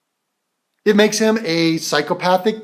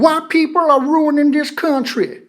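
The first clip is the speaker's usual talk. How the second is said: mock Southern accent; 7 semitones higher than usual. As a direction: parody Southern accent, pitched up.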